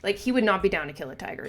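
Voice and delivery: usual speaking voice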